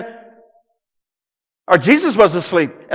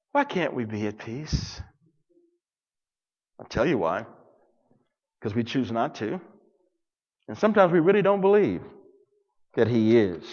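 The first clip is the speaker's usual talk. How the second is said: soft and low; 9 semitones lower, 11 dB quieter than usual. Real, not acted. Preacher drops to soft confession.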